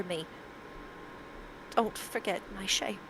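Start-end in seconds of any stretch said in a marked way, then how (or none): 1.8-3.0 soft, hushed quotation; hushed quote. Acted. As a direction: let it drop soft and grave. Reading out what was written.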